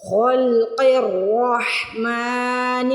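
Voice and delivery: each word recited very slowly, set apart; slow tajweed drill; slow recitation drill. Shown as a demonstration.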